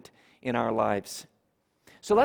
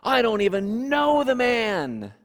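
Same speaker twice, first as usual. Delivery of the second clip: firm, raised denial, higher pitched; flat-out denial with a raised voice; adamant denial; 6 semitones higher, 3 dB louder than usual. Acted. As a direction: insist you never met him. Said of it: "Peter adamantly said".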